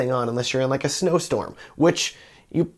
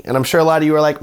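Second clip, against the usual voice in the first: ordinary delivery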